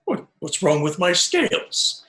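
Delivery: indignant surprise. Real, not acted. Player startled at insult.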